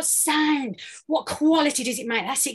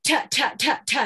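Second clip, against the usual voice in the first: crisp separate T sounds, evenly spaced; chopped consonants; faster than usual. Shown as a demonstration. Coach drilling the class in crisp even T sounds. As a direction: chop each T like a drum hit. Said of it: "like this".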